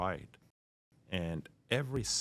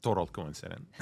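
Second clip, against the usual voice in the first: normal talking voice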